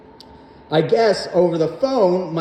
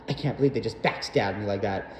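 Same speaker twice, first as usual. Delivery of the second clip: lower, softer wounded diva whine; a hurt reality star muttering to herself; mock Kardashian; 8 semitones lower, 6 dB quieter than usual. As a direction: sulk like a betrayed Kardashian. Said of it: "the way how a Kardashian would feel backstabbed".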